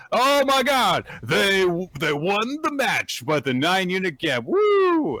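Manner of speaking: high, loud celebrating; excited cheer. Real, not acted. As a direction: cheer the victory. Cheering the win.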